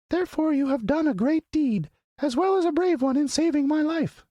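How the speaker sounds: very high, regal little squeak; proud mouse queen. Acted. Mouse queen to the Woodman, proud squeaky voice. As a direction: announce your royalty with tiny pride.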